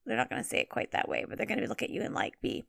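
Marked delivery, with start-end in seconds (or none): none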